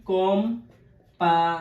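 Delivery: কোম্পানি slow and loud, split কোম, পা, নি; three firm separate beats on কোম্পানি; syllable by syllable. Shown as a demonstration. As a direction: pause between parts of কোম্পানি. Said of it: "ভাগ ভাগ করে উচ্চারণ".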